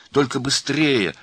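loud, high, drawn-out urging; urgent. A narrator's reading. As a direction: hurry them along, stretching the words. Rushing aunt on the phone.

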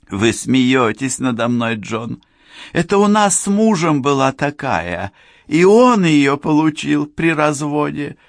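a woman already drugged and giddy; tipsy woman. A narrator's reading. Cheryl voiced, tipsy woman.

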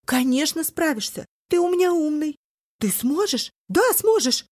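high, loud, ecstatic; frantic cheering. A narrator's reading. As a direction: cheer him on feverishly. Mother's frantic hopeful cheering.

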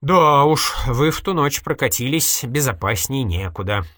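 loud, high opening, then sinking; dryly mocking; sardonic scoff. A narrator's reading. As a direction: hit the start hard and let it drop with irony. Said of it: "хмыкнул Полуянов".

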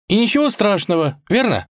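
high-pitched princess seeking agreement; high, breezy questioning princess. A narrator's reading. Princess seeks reassurance from cat.

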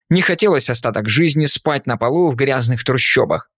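ordinary delivery, nothing marked

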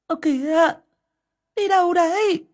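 high, loud startled call. Acted. Bà Hậu calling after her husband as he rides away, high and loud. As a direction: call after someone rushing off.

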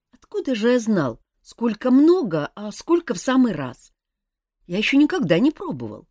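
lightly voiced youth, guilty and eager; a young prince owning up to a friend; young elf prince. A narrator's reading. Young prince Mafey speaking.

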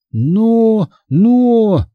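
high, halting protest; bewildered stammer. A narrator's reading. Bewildered Hanna trying to object to her friend. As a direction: falter on each word, at a loss. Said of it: "бормотала Ханна, совсем растерявшись".

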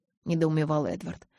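plain reading voice

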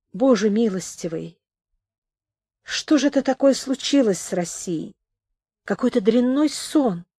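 hushed, pained bewilderment; grieving lament. A narrator's reading. Singer laments Russia's fate.

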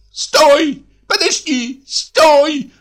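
very high, piercing shout from behind; piercing tenor. A narrator's reading. Man chasing the troikas, piercing tenor shout. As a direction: scream after departing carriages in a piercing tenor. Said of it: "раздался сзади троек пронзительный тенор".

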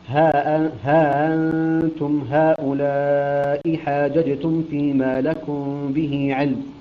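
very slow measured recitation, long held vowels; Quran recitation. Shown as a demonstration. Teacher reciting an example from Al Imran, slow Quran recitation. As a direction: recite slowly, drawing out each madd.

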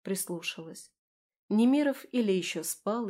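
usual speaking voice

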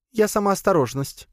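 very high little spider voice; squeaky, playful creature reply; spider pet voice. Acted. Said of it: "откликнулась питомица". (высокий голосок паучихи)

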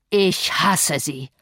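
slow, heavy, hateful declaration; thundering hatred, held down. A narrator's reading. Enraged man striking the table, slow thundering hatred without shouting. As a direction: draw each word out with contained rage. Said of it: "schlug auf den Tisch und donnerte".

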